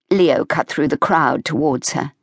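usual speaking voice

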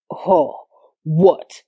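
low, irritated man's reply. A narrator's reading. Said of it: "sounding instantly irritated".

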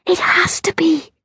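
high-pitched whisper. A narrator's reading. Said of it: "Kirsty whispered".

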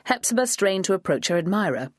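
usual speaking voice

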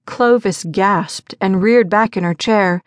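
plain reading voice

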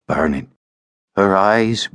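ordinary delivery

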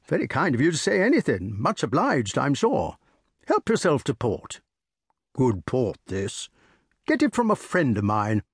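jovial country squire offering port; bluff old squire. Acted. Sir Ector voiced in story.

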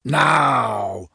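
long, booming giant wizard word; drawn-out giant boom; thundering giant. A narrator's reading. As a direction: thunder it out slowly as a huge wizard. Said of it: "thundered Wizard Winterbottom".

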